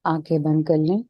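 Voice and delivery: even, unhurried meditation voice with little pitch movement; settled, level relaxation-session delivery; guided meditation. Real, not acted. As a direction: guide them calmly into stillness, leaving space between phrases.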